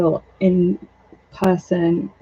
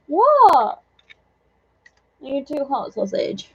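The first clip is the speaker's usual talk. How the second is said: ordinary delivery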